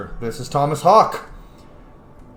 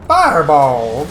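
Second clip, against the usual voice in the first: loud, playful battle cry; gamer's loud attack call; spell call; 7 dB louder, slower than usual. Pretend. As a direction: shout the spell name as you cast it. Calling out a spell in play.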